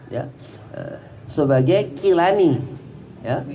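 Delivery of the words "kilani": K sound at the start of Kilani; pronounced with kaf. Shown as a demonstration. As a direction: say Kilani slowly, opening on a K sound. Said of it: "Jadi pakai kaf".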